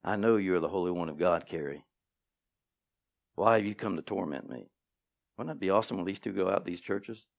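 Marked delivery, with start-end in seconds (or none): 0.0-4.7 lightly voiced demon, lower and softer; a hint of a demon muttering. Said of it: "hear a demon say".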